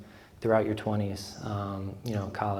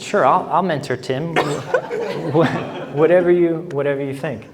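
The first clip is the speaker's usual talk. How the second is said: laughing reply; 5 semitones higher, 6 dB louder, slower than usual. Retold or imitated. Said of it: "I look at him and I said".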